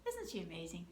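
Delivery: ordinary delivery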